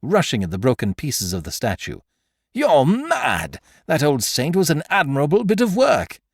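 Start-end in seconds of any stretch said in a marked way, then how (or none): 2.5-6.2 high, louder outraged cry; shouting. A narrator's reading. Magistrate shouting at Beautrelet over the statue, outraged. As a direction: protest the broken saint loudly. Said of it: "shouted Monsieur Filleul".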